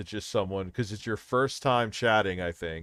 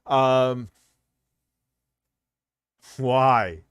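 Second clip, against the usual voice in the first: loud, higher, incredulous yelling; outraged yelling; 5 semitones higher, 7 dB louder than usual. Real, not acted. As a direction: yell it in disbelief, outraged. Outraged rant about talking to minors.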